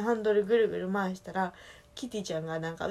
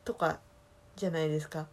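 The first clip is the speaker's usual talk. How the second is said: ordinary talk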